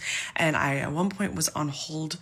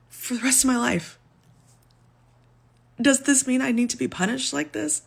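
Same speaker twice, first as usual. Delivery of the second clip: distraught plea; 9 semitones higher, 3 dB louder than usual. Real, not acted. Distraught plea about debt.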